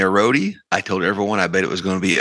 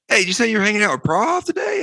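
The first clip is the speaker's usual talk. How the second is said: much higher, half-shouted mock question; imitated excited call; 12 semitones higher, faster than usual. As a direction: seatmate leaning in, excited to hear a name. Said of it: "he's like".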